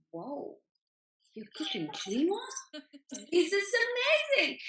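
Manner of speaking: mock amazed squeal; thrilled. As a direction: burst with delighted disbelief. Delighted reply to mum's call.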